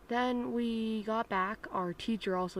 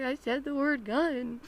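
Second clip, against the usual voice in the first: higher-pitched whiny confession; a touch of hammed-up wailing; mock tearful; 5 semitones higher, 4 dB louder than usual. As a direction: whimper it like a kid in trouble. Repeats tearful confession to mom.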